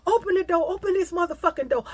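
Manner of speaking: put-on girl outside demanding the door open, angry; banging demand. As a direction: pound on the door with it. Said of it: "the bitch was beating on the door".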